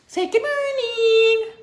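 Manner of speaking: high, slow coaxing. Real, not acted. Coaxing baby.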